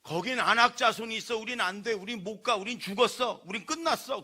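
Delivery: loud, high panicked wail; despairing spies. Retold or imitated. Preacher voicing the ten spies to the congregation, loud panicked wail. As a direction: cry out that all is lost. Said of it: "열 명의 정탐꾼은".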